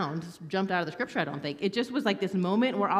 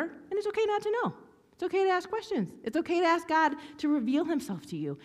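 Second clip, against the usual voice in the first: high gentle reassurance; 9 semitones higher than usual. Pretend. Preacher reassuring her younger self, high and gentle.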